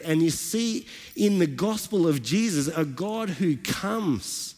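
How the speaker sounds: rising, fervent sermon delivery; preaching. Acted. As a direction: build the praise like a pastor lifting the room. Preaches the passage.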